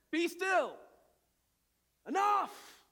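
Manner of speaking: loud firm rebuke; stern command. Acted. Jesus rebuking the wind and waves, loud stern command. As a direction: command the storm to stop. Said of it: "in his frustration".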